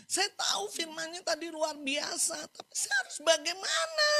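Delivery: high acted crying plea; imitated tearful wail. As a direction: imitate a weeping woman at a loss. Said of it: "dia curhat bahwa dia nangis".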